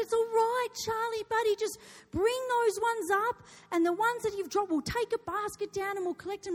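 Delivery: high, loud coaxing call; retold coaxing mum. Mother to crying son about dropped apricots, coaxing call. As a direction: call down encouragingly to an upset child.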